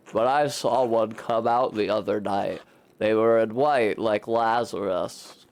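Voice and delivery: low voice for Paul, explaining; Paul, earnest villager. Acted. Paul explains the Roman ruins, low earnest voice.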